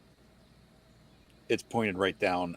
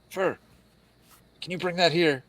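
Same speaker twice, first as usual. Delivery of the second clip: higher-pitched call to someone; lightly raised calling voice; 8 semitones higher, 3 dB louder than usual. Acted. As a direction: call it over to a companion. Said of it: "Cam will call out to".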